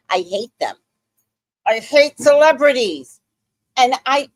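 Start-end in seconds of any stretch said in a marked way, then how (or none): 1.7-4.3 loud fed-up yell at celebrities; angry outburst. Real, not acted. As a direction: blow up in frustration. Ranting about celebrity reviews.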